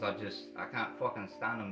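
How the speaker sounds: normal talking voice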